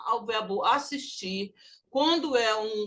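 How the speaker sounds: normal talking voice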